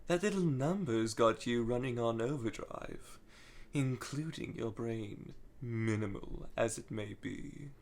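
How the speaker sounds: a quiet, menacing phantom's tease; sinister Starline. Retold or imitated. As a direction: purr it like a cool, taunting phantom. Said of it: "this very cool, sinister tone".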